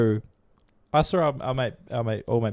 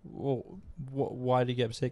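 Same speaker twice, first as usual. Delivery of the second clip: ordinary delivery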